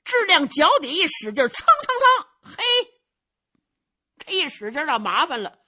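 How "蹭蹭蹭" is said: sharp rising cheng cheng cheng, high and loud; high, loud footstep sound effect; mimicked footsteps. A narrator's reading. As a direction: snap out the running footsteps. Imitating footsteps on roof.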